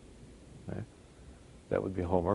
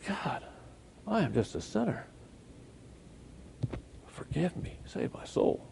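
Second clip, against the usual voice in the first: soft whispered plea for forgiveness; humble whispered prayer; 4 semitones higher, 11 dB quieter than usual. Retold or imitated. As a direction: whisper it like a broken sinner. Retelling his own humble repentance prayer.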